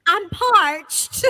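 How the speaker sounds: mock Southern parched plea; hammed-up Southern accent. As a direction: drawl it like a wilting Southern belle. Joins the Southern sweet-tea bit.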